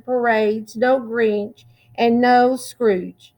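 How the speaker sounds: ordinary delivery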